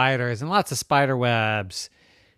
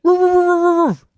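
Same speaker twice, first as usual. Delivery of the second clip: loud high-pitched protest barks; dog barking; 12 dB louder than usual. Acted. Doggy answering Rose, barking in protest. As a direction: bark back at the accusation. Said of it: "And doggy said".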